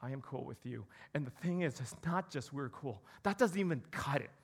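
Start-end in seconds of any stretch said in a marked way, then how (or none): 0.0-0.8 relaxed reassurance. Pretend. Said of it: "God looks at me then and says".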